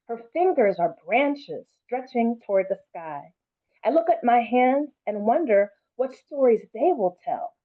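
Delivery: normal talking voice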